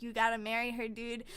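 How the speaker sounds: low, casual nudge from a father; lightly voiced emperor dad; urging dad. Dad urges prince by mind magic.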